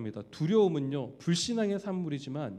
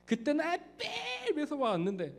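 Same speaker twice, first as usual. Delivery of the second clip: put-on higher, bratty teen talking back to dad; sulky teenager; 10 semitones higher, 5 dB quieter than usual. (아빠에게 대드는 사춘기 아이, 높은 목소리로 툴툴대기)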